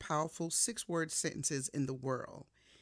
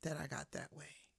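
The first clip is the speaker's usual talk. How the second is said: quiet apology; 11 dB quieter than usual. Retold or imitated. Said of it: "I had to sit with it".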